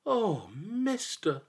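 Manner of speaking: high, slow sorrowful call; sad cry. A narrator's reading. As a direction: cry out to a dead friend, slowly. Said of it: "cried Fear Not, sad in his heart".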